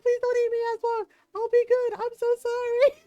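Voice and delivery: high, loud mock wail; retold crying plea. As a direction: plead for your life like a panicked little brother. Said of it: "He stops crying for a minute".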